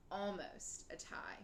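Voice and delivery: ordinary delivery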